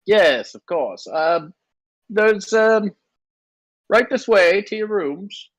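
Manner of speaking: high, helpful gnome voice; obliging gnome. Acted. Gnome shows guests to their rooms, high and obliging. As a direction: usher them along in a high gnome voice. Game master plays the gnome.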